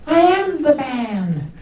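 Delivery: high, slow squeaky insistence; squeaky indignant reply. Retold or imitated. Comic insisting on the phone he is the man. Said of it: "this squeaky little voice".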